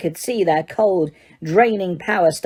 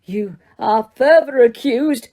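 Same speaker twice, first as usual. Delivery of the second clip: slow, higher, booming charge; bellowing; 5 semitones higher, 3 dB louder than usual. Acted. Judge bellowing charges at the prisoners. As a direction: bellow the accusation. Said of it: "bellowed Mister Crouch".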